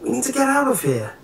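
high-pitched, pressing insistence; urgent plea. A narrator's reading. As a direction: urge your friends to escape, pitch way up. Acted urgent plea to escape.

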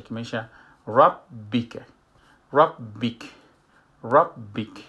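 ربک with two b sounds, rabb-ak; doubled b demo. Shown as a demonstration. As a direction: hold the b of ربک before the vowel. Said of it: "دو با هسته".

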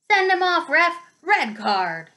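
loud, high yell at the referee. Acted. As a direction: bellow it from the stands. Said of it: "Dean Thomas was yelling".

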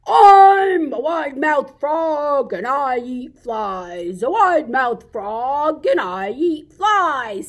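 slow, loud, stretched-out frog voice; wide-mouthed frog. Acted. Frog jumping round the jungle announces himself, big frog voice. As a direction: boast it big with drawn-out swooping vowels. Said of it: "said the wide-mouthed Frog".

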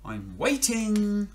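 high, slow, mock-impatient platypus. Platypus to frog, mock-impatient and slow. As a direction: drag it out, tapping a foot.

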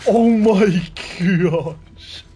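drawn-out loud oh my gosh; stunned, stretching every word; shocked gasp. Real, not acted. After a jump scare.

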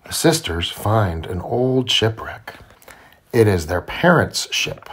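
ordinary delivery

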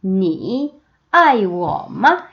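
very slow, syllable by syllable; slow teacher demo; slow model reading. Shown as a demonstration. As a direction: spell out 你很漂亮 slowly. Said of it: "어떻게 중국어로".

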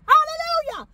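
soaring high praise cry; ecstatic hallelujah. Real, not acted. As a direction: burst out in joyful praise.

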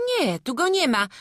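put-on loud, high child's reply; village children's voices. A narrator's reading. Children report back to the villagers, high loud calling. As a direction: pipe up loudly, high-pitched. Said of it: "odezwało się kilkoro malców".

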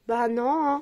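usual speaking voice

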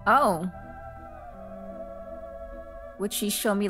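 normal talking voice

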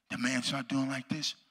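ordinary talk, nothing marked

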